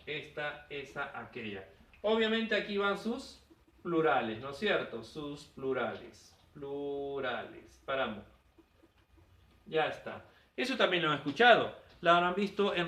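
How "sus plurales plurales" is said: slow repeat. Shown as a demonstration. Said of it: "repeating term slowly".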